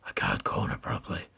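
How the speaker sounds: deep Batman growl; gravelly Batman voice. Pretend. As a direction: talk like Batman. Batman voice held.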